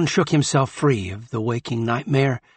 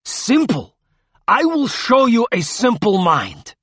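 high, loud, enraged retort; raised voice full of threat; furious outburst; 11 semitones higher, 6 dB louder than usual. A narrator's reading. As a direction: throw the word back in outrage. Furious grandfather punishing the boy.

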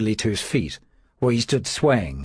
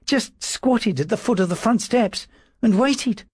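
high, thin timid clerk's voice; a frightened servant trying to sound firm; nervous little secretary; 9 semitones higher than usual. A narrator's reading. Dawes answering his lordship's questions, high and nervous.

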